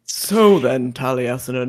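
very low, put-on co-host voice; Bricko. Host tries inhabiting the co-host's role, low impression. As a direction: drop deep to impersonate your co-host. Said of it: "You could do the Bricko voice".

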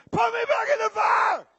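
high, full-voiced scream; screaming plea. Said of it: "Then you're screaming".

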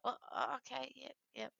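imitating someone slowly waking; half-asleep, hesitant agreement. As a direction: come round from sleep, agreeing. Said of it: "slowly j-, waking from his sleep".

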